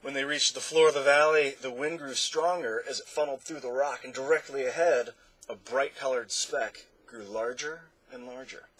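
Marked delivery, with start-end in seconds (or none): none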